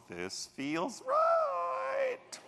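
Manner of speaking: high, slow airy voice, arms spread; dreamy wife. Acted. Wife in the living room, a high slow airy verdict.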